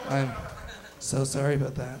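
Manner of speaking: mock apology, quiet. Mock apology under breath.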